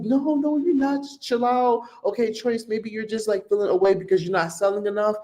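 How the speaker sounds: hammed-up high voice brushing off an angry recruit; mocking the recruiter. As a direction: squeak it out like a patronizing, dismissive upline. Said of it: "And she was like".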